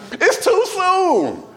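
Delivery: high, drawn-out mock wail; mock mourner cry. Preacher mocking the mourner's cry, slow hammed-up wail. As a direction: stretch it out like a wailing mourner.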